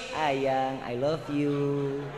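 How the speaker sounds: soft, low and very slow lover's murmur; a husband showing wives how to say I love you; tender sweet talk. Shown as a demonstration. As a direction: coo it slowly to your spouse. Demoing loving words to wife.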